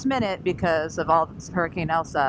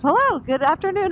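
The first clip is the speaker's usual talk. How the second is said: cheery flight attendant; 11 semitones higher, 4 dB louder than usual. Retold or imitated. Flight attendant greeting passengers.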